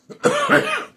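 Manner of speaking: harsh hacking cough; smoker's cough. Real, not acted. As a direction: cough hard, chesty and rough. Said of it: "it's my smoker's cough".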